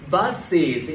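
"buses" slow, two syllables, BUS-iz; added syllable. Shown as a demonstration. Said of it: "cộng thêm một âm tiết". (đọc "buses" chậm, rõ hai âm tiết)

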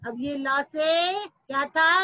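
ordinary delivery

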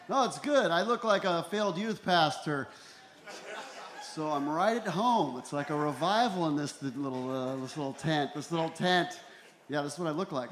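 ordinary delivery

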